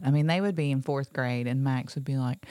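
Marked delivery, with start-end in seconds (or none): none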